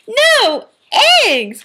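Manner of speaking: high, loud shocked shout; crying out. Acted. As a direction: throw up your hands in horror. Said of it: "cried Farmer Brown".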